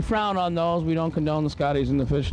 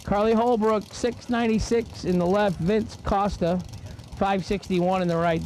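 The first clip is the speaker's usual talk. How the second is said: race announcer; 5 semitones higher than usual. Acted. Announcer to the grandstands, calling each run's numbers in an announcer read. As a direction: drag strip PA announcer calling times.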